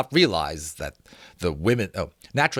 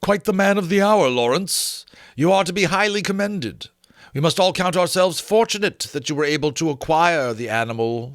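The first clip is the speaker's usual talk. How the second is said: higher, louder lofty praise; condescending praise; 8 semitones higher, 4 dB louder than usual. A narrator's reading. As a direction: patronise him with grand compliments. Winsdale voiced, mock praise.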